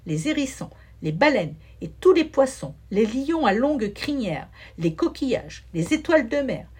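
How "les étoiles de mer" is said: plain reading voice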